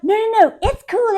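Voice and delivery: high, placating mock husband; mock reassurance. Husband placating his confused wife, high mock reassurance. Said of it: "And then he's like".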